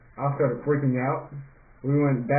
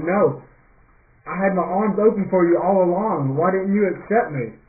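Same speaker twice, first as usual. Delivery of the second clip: higher, louder voiced reproach; God pleading; 5 semitones higher, 5 dB louder than usual. God speaks to people left behind, pleading reproach. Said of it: "maybe he's feeling the same thing".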